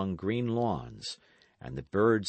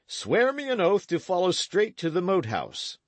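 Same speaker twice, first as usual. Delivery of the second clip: put-on stern old knight, raised and commanding; a gruff knight explaining himself to the boys; Sir Daniel; 10 semitones higher, 5 dB louder than usual. A narrator's reading.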